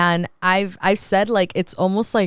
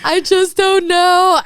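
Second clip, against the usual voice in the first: retold crying; 11 semitones higher, 5 dB louder, slower than usual. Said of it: "I'm, like, full on crying".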